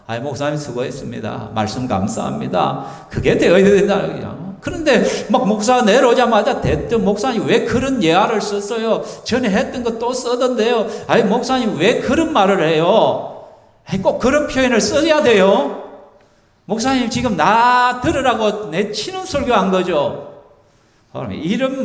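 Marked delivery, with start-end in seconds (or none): 0.1-3.1 soft, quieter thanks to the pastor; grateful congregant. Pretend. 7.1-20.3 mock nagging, whiny complaint to the pastor; complaining congregant. Congregant nags pastor after sermon.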